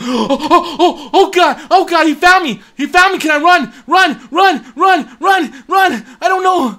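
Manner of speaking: high, loud screaming panic; high, loud screaming run; terrified. Real, not acted. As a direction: flee shrieking. Caught and fleeing.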